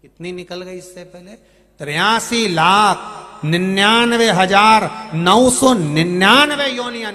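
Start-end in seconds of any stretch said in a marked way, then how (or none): 1.8-7.1 loud, higher preacher's proclamation; full-force preaching. Real, not acted. Preacher stressing the count of past births to devotees, full-force preaching. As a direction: ring out the huge count, long-drawn at first.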